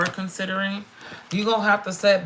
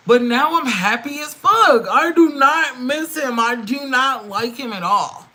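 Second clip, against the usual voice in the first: much higher, louder, triumphant; loud happy defiance toward the ex; gleeful defiance; 6 semitones higher, 6 dB louder than usual. Real, not acted. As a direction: crow about being free. Gleeful after divorce, loud.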